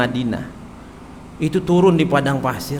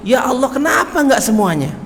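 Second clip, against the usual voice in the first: raised cry of longing; mock cry; 6 semitones higher than usual. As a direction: call out higher, yearning. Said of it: "teriaknya tiga kali". (teriakan menyesal, lebih tinggi)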